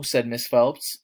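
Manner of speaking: usual speaking voice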